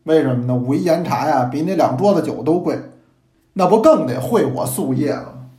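usual speaking voice

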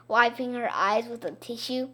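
usual speaking voice

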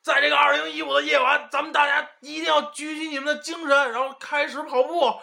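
high shouted hype MC voice; YY shouting MC. Retold or imitated. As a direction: bark it like a gym coach. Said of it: "教练就像那个Y Y喊麦一样".

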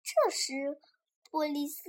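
usual speaking voice

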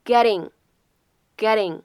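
"getting" with the double T as a quick flap; "getting" said slowly, tongue flicking the middle T; American flap T. Shown as a demonstration. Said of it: "美式发音当中常有的闪音的处理".

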